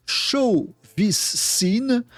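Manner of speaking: slow, high-pitched English with a shaky French accent; bad English accent. Real, not acted. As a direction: read the English very slowly in a clumsy French accent. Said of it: "mon accent anglais est miteux". (anglais lent avec un mauvais accent français)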